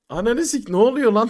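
loud, stunned cursing burst; shocked shout. Retold or imitated. To his friends, realising the girl is real, shocked loud cursing. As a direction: blurt it out in loud disbelief.

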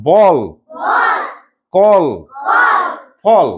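বল, কল, ফল each with a long "aw". Shown as a demonstration. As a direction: model বল, কল, ফল one by one for repeating. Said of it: "উচ্চারণটা অ এর মতো হচ্ছে".

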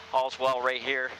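ordinary delivery

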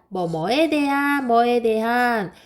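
"뭐에 대한" stretched out slowly; slow, sustained stress on each "뭐에 대한"; key phrase emphasized. Shown as a demonstration. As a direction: draw out "뭐에 대한" like a phrase to memorize. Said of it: "이게 아주 중요해".

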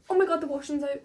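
sudden loud dismayed outburst; alarmed exclamation. Real, not acted. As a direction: suddenly realise the laundry is out. Notices washing out in rain.

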